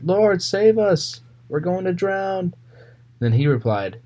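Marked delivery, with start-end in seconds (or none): 0.0-2.5 high, slow, desperate cry for help; panicked plea. Acted. Retelling the disciples begging the sleeping Jesus, high and slow. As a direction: beg for rescue as the boat sinks.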